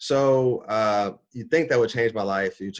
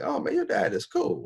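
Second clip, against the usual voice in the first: put-on much higher, kid-like voice saying it with awe; impressed kid. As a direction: play an impressed teenager praising a cool dad. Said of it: "be like".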